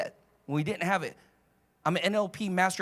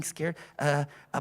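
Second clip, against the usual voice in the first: ordinary talk, nothing marked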